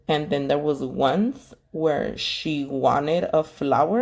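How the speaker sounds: ordinary talk, nothing marked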